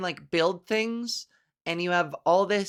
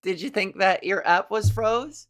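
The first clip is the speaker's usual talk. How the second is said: ordinary talk, nothing marked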